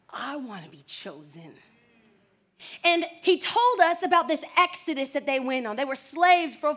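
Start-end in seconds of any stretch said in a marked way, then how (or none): 0.1-1.5 put-on soft, low childlike wish; wistful hush. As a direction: breathe it as a longing little girl. Child's quiet longing in memory.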